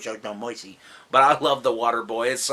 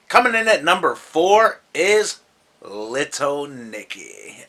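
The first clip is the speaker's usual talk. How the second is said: loud countdown announcer, pitched up; hype announcer; 2 semitones higher, 4 dB louder than usual. Acted. Countdown announcement, louder and higher.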